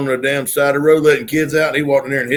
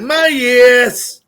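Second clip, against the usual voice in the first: high, louder drawn-out "My yes"; Southern accent; 8 semitones higher, 5 dB louder than usual. Retold or imitated. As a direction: imitate a fired-up Southern friend. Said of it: "Chad will get fired up, mad at me, and be like".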